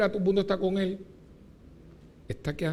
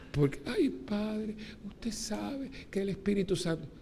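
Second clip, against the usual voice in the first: higher and softer mimicry of a pious woman; mocking affected churchgoer; 5 semitones higher, 6 dB quieter than usual. Priest mocking a woman's overdone pious talk to him. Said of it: "La gente no habla así".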